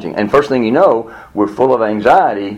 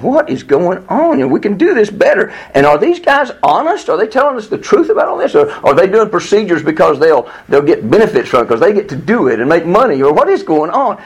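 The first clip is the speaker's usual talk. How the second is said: high, agitated run of suspicious questions; worked up; 7 semitones higher than usual. Retold or imitated. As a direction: reenact mounting frustration with the doctors. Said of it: "I'm getting all worked up".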